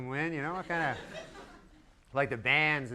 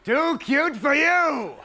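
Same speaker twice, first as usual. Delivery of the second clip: high, loud, very slow showbiz announcement; hammed-up announcer; 10 semitones higher, 6 dB louder, much slower than usual. Host introduces kid dancers, hammed-up high announcer call. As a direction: stretch it out like a TV host.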